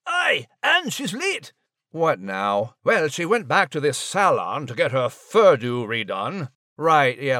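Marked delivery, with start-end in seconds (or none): 0.1-1.5 higher, slow Scots brogue; cheeky Scottish mouse; Scottish Max. Acted. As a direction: drag out the Scottish quip. Max character quips.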